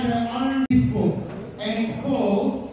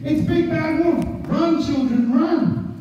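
much higher, louder alarmed cry; panicked; 7 semitones higher, 6 dB louder than usual. Acted. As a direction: shriek the warning to the chicks. Chicken panics, calls chicks inside.

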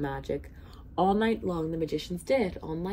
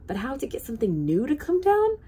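high, loud wondering question; dramatic question; 9 semitones higher, 5 dB louder than usual. A narrator's reading. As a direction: ask it with big suspense. Narrator asks dramatically.

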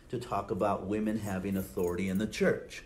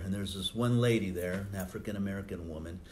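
ordinary talk

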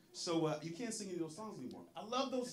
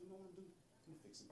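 whispered plan; 16 dB quieter, faster than usual. Retold or imitated. Secret plan whispered to cousin.

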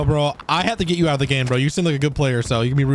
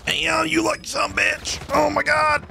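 outraged yell; 9 semitones higher than usual. Real, not acted. Player yelling at a lucky enemy, real outraged shout. Enemy survives by luck, he yells.

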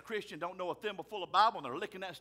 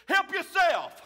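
higher, louder mocking help yourself; sarcastic outburst; 10 semitones higher, 9 dB louder, slower than usual. Real, not acted. Preacher scoffing at dressy preachers, loud sarcastic jab. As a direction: toss it off with sarcasm.

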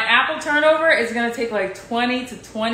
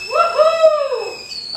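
excited whoop. Real, not acted. Cheering at her new tea kettle on the live. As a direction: let out a joyful whoop.

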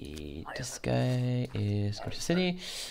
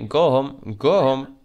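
firm go home; 6 dB louder than usual. Acted. Dismissing entrant.

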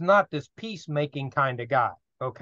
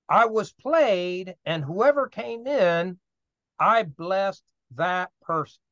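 slow dismay; slower than usual. Retold or imitated. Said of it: "That's what he's saying here".

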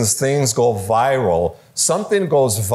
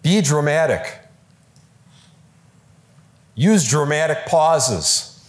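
slow, with long dramatic pauses between phrases; 5 semitones higher, slower than usual. Shown as a demonstration. Preacher showing priests how to use dramatic pauses. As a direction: pause to let the word land. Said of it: "like I'm doing right now".

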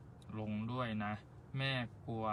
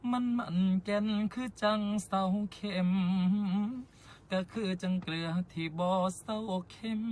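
higher, lightly sung-through love lines; recited love verse; 7 semitones higher, 5 dB louder than usual. Acted. Singer reciting love lines to his fans, tender and lilting. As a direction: croon the sweet lines to the fans.

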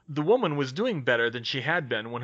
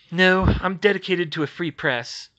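louder firm concession; conceding; 5 semitones higher, 8 dB louder than usual. Acted. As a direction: Hermione admitindo com firmeza. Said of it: "Hermione conceded".

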